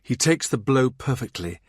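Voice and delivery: plain reading voice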